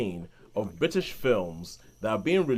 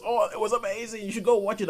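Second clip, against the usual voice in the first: much higher, gushing recommendation; gushing Sean; 9 semitones higher than usual. Retold or imitated. Sean raving about a film to his co-hosts, high and gushing. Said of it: "finds them and says".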